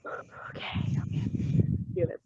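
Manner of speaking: whispered self-pep. Real, not acted. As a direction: breathe it to yourself to calm down.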